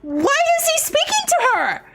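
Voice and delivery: put-on outraged Valley Girl gossip, climbing high. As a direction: gush and seethe like a Valley Girl. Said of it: "I can do Valley Girl".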